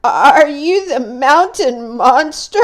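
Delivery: trembling call. Acted. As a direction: call out bravely but scared. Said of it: "voice trembled as he called out".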